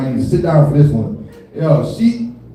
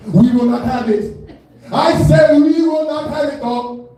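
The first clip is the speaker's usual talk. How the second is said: high-pitched, drawn-out indignant declaration; indignant and insistent, voice climbing; emphatic refusal; 8 semitones higher, slower than usual. Acted. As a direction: put your foot down, pitched high. Declaring refusal at top of set.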